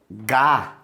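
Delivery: gá with a hard g sound. Shown as a demonstration. Teacher modelling gá with a hard g. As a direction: say gá with a hard g, like in galinha. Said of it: "Se você tem aqui".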